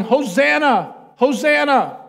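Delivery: high, loud, drawn-out Hosanna; yelling crowd. Retold or imitated. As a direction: yell Hosanna as the whole crowd. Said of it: "everybody is yelling".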